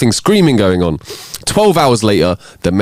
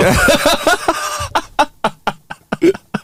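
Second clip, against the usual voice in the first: high breathless laughter; real laugh that runs on. Real, not acted. Laughing at a long joke setup, high breathless laugh.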